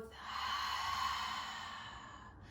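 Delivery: long, airy sigh through the mouth; gentle audible exhale. Shown as a demonstration. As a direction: breathe out slowly and audibly. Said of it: "gentle exhale through the mouth".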